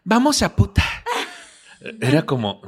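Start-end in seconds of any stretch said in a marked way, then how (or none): 0.0-0.8 high, put-on cartoon cheer; imitated dubbing voice. Friend recalling a dubbed cartoon line, high imitated voice. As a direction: call it out like a high cartoon character. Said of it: "había uno de puta".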